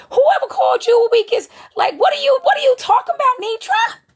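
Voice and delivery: high-pitched, disbelieving challenge; incredulous retort. Real, not acted. Viewer answers Nitra's claim of being the weakest, incredulous. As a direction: push the voice way up in disbelief.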